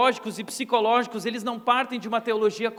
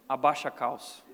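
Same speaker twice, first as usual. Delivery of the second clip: stern father's order; 8 semitones lower than usual. Retold or imitated. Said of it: "o pai falava aquelas palavras assim".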